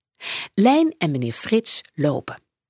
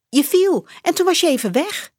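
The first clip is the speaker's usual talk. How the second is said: put-on high girl's voice, a bit louder; young girl; 11 semitones higher, 4 dB louder, faster than usual. A narrator's reading. Lijn tells Edwin he fainted, high girl's voice. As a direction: explain it like an eager young girl.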